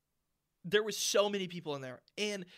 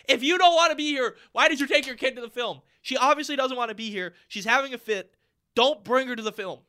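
loud, higher, indignant scolding; exasperated rant; 5 semitones higher, 10 dB louder than usual. Real, not acted. Moviegoer venting at parent over child, loud exasperation. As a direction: let the frustration burst out at a careless parent. Ranting at the mother.

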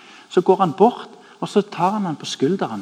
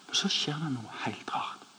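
whispered, building suspense; hushed whisper; 13 dB quieter than usual. Acted. Preacher hushing the congregation before the twist, whispered. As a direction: lean in and whisper it.

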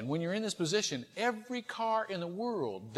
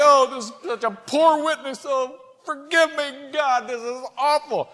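high, pleading self-reproach; hammed-up cry for forgiveness; mock anguish; 7 semitones higher, 6 dB louder than usual. Acted self-reproach, beating his head.

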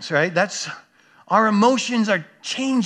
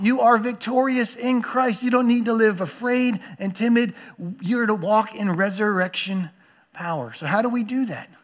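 normal talking voice

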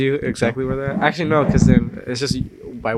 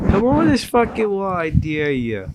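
higher, drawn-out mock taunt; famous video impression; 6 semitones higher, 3 dB quieter, slower than usual. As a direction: imitate a goading dare from a video. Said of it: "this video is just like".

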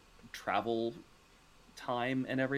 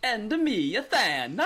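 squeaky high put-on Italian voice; mock Mario voice; 13 semitones higher, 8 dB louder, slower than usual. Co-host joining the Mario bit, high mock Italian voice. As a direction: chirp it like a cartoon plumber.

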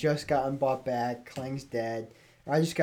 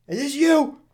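high, loud retold call; acted urgent question; 12 semitones higher, 8 dB louder than usual. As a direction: call it out in a raised, squeaky pitch. Panicked retelling of game exchange.

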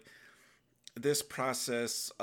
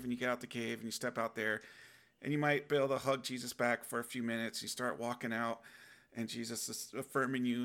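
ordinary talk

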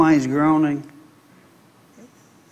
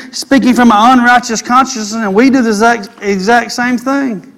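raised, higher-pitched pulpit voice; preaching; 7 semitones higher, 4 dB louder than usual. Real, not acted. Preacher explaining righteous consciousness to the congregation, raised preaching voice. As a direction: preach it with driving force. Preaches nothing impossible for God.

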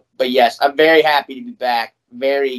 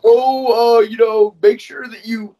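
much higher, prissy mock of the management; mocking the landlord; 10 semitones higher, 3 dB louder than usual. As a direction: parody their note in a higher voice. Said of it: "They were like".

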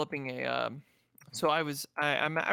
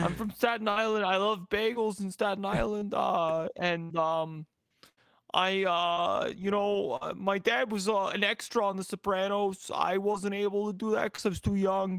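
higher-pitched Staten Island character voice; shitty Northeastern accent; 5 semitones higher than usual. Acted. Staten Island man introducing himself, hammed-up Northeastern accent. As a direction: lay on a bad New York accent. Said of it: "like, a really shitty Northeastern".

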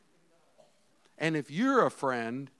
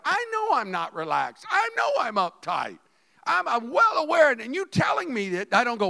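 loud, raised, insistent preaching; building sermon fervor; fiery preaching; 7 semitones higher, 6 dB louder than usual. Acted. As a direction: push it hard from the pulpit. Preaching at full force.

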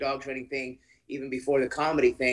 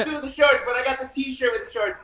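teasing; 11 semitones higher, 4 dB louder than usual. Real, not acted. Teasing about host's outfit.

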